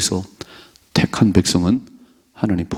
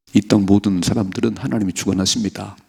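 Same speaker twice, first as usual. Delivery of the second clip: ordinary talk, nothing marked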